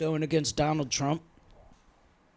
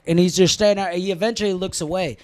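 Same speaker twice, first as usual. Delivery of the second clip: ordinary delivery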